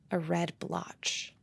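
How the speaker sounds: ordinary talk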